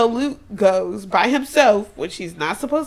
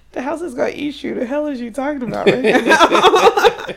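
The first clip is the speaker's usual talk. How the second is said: fast then loud and laughing; laughing disbelief; 5 semitones higher, 5 dB louder than usual. Real, not acted. Friend yelling at a character on screen, laughing.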